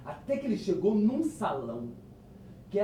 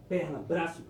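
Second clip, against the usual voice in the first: ordinary delivery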